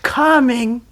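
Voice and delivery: replaying the pupil's shouted reply; loud coming. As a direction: hurl the word back. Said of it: "a million shades of meaning".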